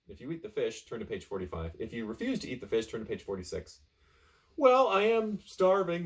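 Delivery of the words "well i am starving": castaway voice. Acted. Castaway voice in the story.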